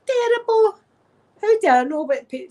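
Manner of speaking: normal talking voice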